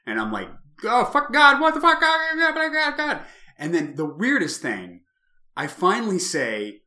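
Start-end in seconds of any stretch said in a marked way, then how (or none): 0.8-3.2 loud, very high panicked scream; frantic high shriek, acting out terror; re-enacted screaming. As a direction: scream as if shaking someone who won't wake. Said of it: "shaking her and screaming at her".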